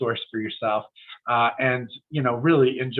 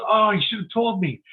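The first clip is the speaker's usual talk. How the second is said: mock surprise; 10 semitones higher, 3 dB louder than usual. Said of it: "And he goes".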